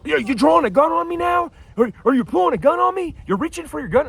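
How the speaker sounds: high-pitched imitation of a man yelling accusations; hammed-up panicky shout for the phone's benefit; mock yelling. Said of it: "he yells".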